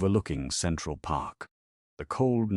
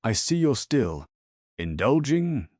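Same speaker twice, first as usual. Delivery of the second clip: smooth, higher-pitched needling with weight on the last word; 6 semitones higher, 4 dB louder than usual. A narrator's reading. Trevor needles Daisy about her hips, smoothly. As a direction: say it smoothly, drawing out the insult. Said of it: "as Trevor said smoothly".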